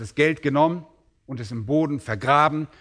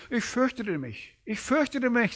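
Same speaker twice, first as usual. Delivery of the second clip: fearful servant; 8 semitones higher, 5 dB quieter than usual. Retold or imitated. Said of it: "Also sagt er in Vers fünfundzwanzig".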